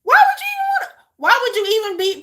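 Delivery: very high, loud squawk of disbelief; incredulous outburst. Real, not acted. Reactor questioning the wife's choice, high incredulous squawk. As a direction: shoot up high and loud, baffled.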